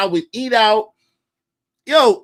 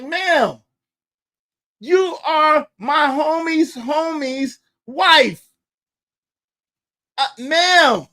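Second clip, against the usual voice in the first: stunned plea; 8 semitones higher, much slower than usual. Retold or imitated. Pleading with the angry wife.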